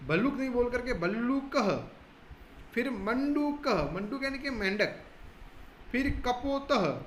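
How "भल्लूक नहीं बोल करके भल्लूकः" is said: भल्लूकः with a long drawn "luu" and a visarga "-kaha"; भल्लूकः, not भल्लूक, with the final ha sounded. Shown as a demonstration. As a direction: stretch the लू in भल्लूकः. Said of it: "लू को बड़ा बोलना".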